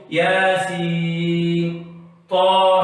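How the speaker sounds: ياسين drawn out very slowly in a chant; Quranic letter recitation. Shown as a demonstration. Teacher chanting letters slowly for student, recited. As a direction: chant ياسين long and melodic. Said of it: "شوف".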